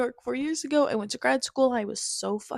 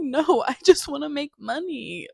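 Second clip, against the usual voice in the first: laughing; 5 semitones higher, 6 dB louder than usual. Real, not acted. Laughing as she confesses to viewers she only wants money. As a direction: say it louder through a laugh.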